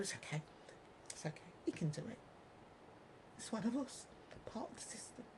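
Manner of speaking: mock conspiratorial whisper. Mocking corrupt insiders.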